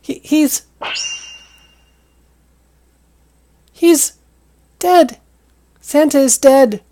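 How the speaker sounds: high, loud squeaky voice, slowing on the last words; squeaky dramatic dread. Acted. High squeaky character tells the officer Santa is dead. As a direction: break terrible news in a high squeak.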